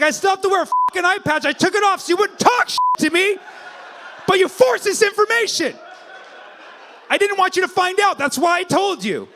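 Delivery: high, loud hammed-up fury; mock angry shout. Comic voicing the eye-patch guy yelling back at him, mock angry. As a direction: rant like a wounded, furious man. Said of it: "He's like".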